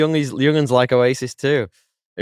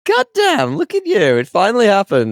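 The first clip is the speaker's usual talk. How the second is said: high, loud amazed outburst; excited; 12 semitones higher, 6 dB louder than usual. Real, not acted. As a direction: burst out in delighted disbelief. Host amazed at co-host's haircut.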